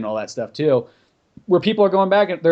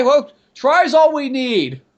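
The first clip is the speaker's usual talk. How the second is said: loud, high, drawn-out cartoonish voice; mock eager approval; 11 semitones higher, 6 dB louder, much slower than usual. Developer accepts Bob's attempt at the accent, loud high mock cheer. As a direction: belt it out slowly, high and hammed-up.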